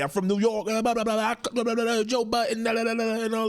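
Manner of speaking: high, ranting impression of [name]; mocking [name]. Mimicking [name] showing off about New York. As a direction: rattle it off like Cardi bragging. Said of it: "we know Cardi to be a hot head".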